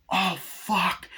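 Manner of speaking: high mock-horrified curse; shocked curse. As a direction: curse in acted dread. Acted shock at blood bursting.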